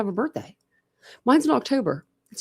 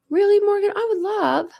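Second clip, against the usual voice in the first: high, gasping "really?"; a real delighted gasp; gasping surprise. Real, not acted. Reacting to viewer's news.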